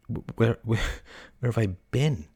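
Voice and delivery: dazed, stumbling repetition; slow, halting. A narrator's reading. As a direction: falter slowly over the question. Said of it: "he said slowly".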